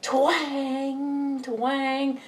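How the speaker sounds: high twanging spring noise; imitated spring twang. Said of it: "it was like".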